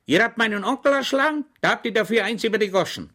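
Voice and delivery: high, loud, excited common soldier holding forth; Schweig's eager voice. Acted. Schweig predicting war to his pub neighbour, high and loud. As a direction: rant it like a beer-hall prophet.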